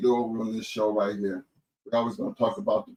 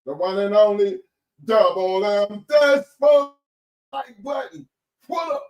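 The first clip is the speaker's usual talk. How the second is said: high, loud DJ shout-out voice; hype announcer; 13 semitones higher, 6 dB louder, slower than usual. Acted. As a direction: call out each name like a radio DJ.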